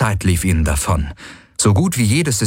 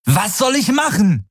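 high-pitched exasperated outburst; angry, desperate cry; 13 semitones higher than usual. A narrator's reading. Galen answers Kirai's demand, angry and desperate. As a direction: throw it back in open frustration. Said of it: "ohne seine eigene Wut oder Verzweiflung zu verhehlen".

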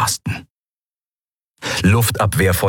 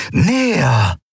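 higher, insistent push; urging; 5 semitones higher than usual. A narrator's reading. Eidolon pushing Kai's hand forward, urgent higher call. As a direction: press harder to come closer. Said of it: "drängte das Eidolon".

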